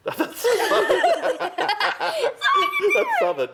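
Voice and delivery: words squeaked out through laughing; laughing hard, pitch shooting right up; helpless laughter. Real, not acted. Cracks up at her accent.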